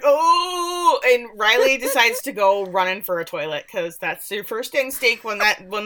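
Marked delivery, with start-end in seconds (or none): 0.0-1.0 high, loud mock "Oh!" of gut panic; hammed-up belly alarm; stomach-cramp groan. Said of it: "he's like".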